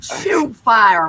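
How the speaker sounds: loud, high hammed-up southern exclamation; exaggerated southern yell. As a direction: burst out like a cartoon Southerner. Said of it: "I was being so stereotypical".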